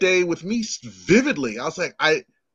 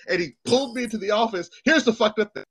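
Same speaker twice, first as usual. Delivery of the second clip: usual speaking voice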